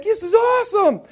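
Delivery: high, loud delight; so excited. Retold or imitated. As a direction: crow it with glee. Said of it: "I was so excited".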